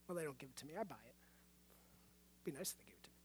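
very soft, low throwaway aside; muttered aside. Real, not acted. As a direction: mutter it under your breath, dropping low. Joking aside about buying coffee.

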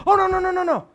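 high, loud string of refusals; urgent self-check. Acted. Man stopping old bad feelings as they return, loud urgent refusal. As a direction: halt the thought with a hammed-up, high-pitched protest.

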